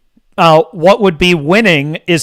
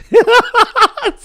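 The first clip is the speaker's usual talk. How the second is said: a burst of high mocking laughter; gleeful high-pitched cackle; high cackling laugh. Real, not acted. Mocking the other side's meltdown.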